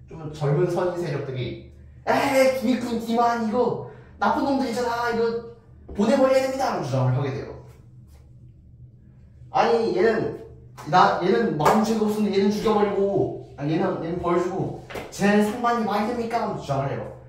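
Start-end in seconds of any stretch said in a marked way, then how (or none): 2.1-6.7 high, loud, outraged protest; indignant young scholars. Acted. Young Seoin demand punishment. 9.5-16.5 high, loud complaint about unfairness; indignant protest. Acted. Young Seoin protest unfair punishment.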